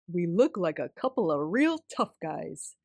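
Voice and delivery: a hint of a gruff tough guy, playful; grandma clowning for granddaughter, mock tough. A narrator's reading. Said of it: "in a tough guy voice".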